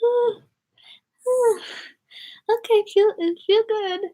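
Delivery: very high squealing oohs of ticklish delight; mock pleasure squeal. Retelling the pedicure toe rub to viewers, very high squeal. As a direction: squeak it like the toe rub caught you off guard. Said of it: "And I was like".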